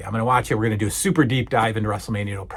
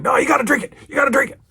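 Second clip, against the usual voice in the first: very high, insistent impression; Mister T; 12 semitones higher, much faster than usual. Mister T urging Hulk while chugging, insistent impression. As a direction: insist urgently in a raised mock voice. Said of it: "Mister T's chugging it".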